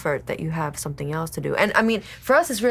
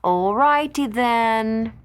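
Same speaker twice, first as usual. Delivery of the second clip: slow, high comic goofy voice; silly drawn-out sign-off; 5 semitones higher, 4 dB louder, much slower than usual. Acted. Wife signing off the show in a goofy slow voice. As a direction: stretch it out like a cartoon.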